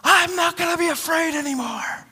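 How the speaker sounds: high, strained call. Retold or imitated. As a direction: raise the voice to sound like you mean it. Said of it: "you say it like you mean it".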